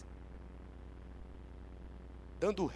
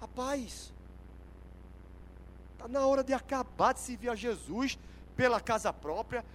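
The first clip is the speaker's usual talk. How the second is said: ordinary talk, nothing marked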